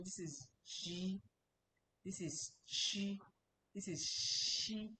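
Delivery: slow, careful "G", "Chi", "Shi", each syllable drawn out; slow sound demo. Shown as a demonstration. Teacher to students, slow careful demo of "G", "Chi", "Shi". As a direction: spell out "G", "Chi", "Shi" slowly for learners. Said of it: "I hope you can get it".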